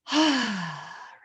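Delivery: big exhaling sigh; demo deep sigh. Shown as a demonstration. Coach demonstrates a deep breath, heavy sigh. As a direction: breathe out audibly. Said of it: "Deep breaths".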